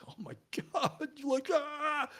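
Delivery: high-pitched mock dismay; mock exasperation. Watching false TV coverage of the case, high mock exasperation. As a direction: act out exasperation in a high, soft wail.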